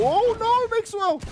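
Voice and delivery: very high shocked cry; shocked shout. Real, not acted. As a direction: yell it at the ambush. Sudden ambush on Mixwell.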